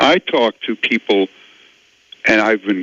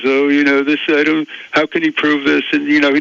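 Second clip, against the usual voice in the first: mocking critic voice; 3 semitones higher, faster than usual. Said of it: "all they can say is".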